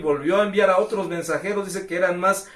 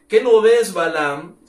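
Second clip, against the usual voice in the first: donkey's loud protest; 4 semitones higher, 10 dB louder, slower than usual. Acted. Donkey rebukes its master.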